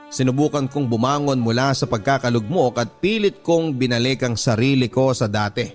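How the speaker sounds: normal talking voice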